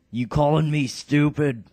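offended brute. Acted. Bartray taking offense, loud indignant brute voice. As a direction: bristle like a touchy heavy. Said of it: "the stupid character".

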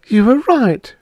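ordinary delivery

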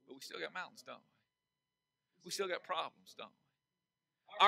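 soft, low rhetorical question; hushed aside. Real, not acted. Preacher quietly asking the congregation, hushed and low. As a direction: drop to almost nothing.

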